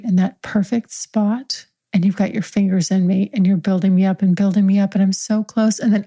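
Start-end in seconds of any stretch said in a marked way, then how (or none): none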